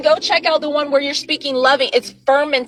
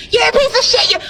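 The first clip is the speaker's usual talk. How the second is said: higher, louder, spitting the insult out; mock hateful insult; 10 semitones higher, 3 dB louder, faster than usual. Shown as a demonstration. Demonstrating how people curse at a water bottle, in a raised mock-hateful voice. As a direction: sneer it at the bottle like you despise it.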